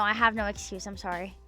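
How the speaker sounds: ordinary talk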